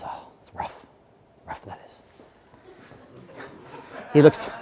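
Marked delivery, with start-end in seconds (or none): 0.0-1.9 whisper. Acted. Middle dog answers sad story.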